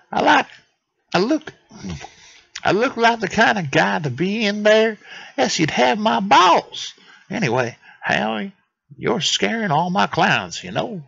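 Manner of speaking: high, indignant mock-Italian accent; Anatoly. Anatoly complains to Howie, high indignant mock-Italian accent. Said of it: "that's gonna be the accent I give him".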